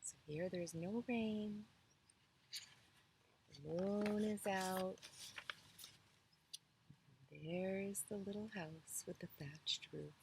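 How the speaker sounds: calm, as if pointing at the picture; hushed. A narrator's reading. Soft picture-book aside.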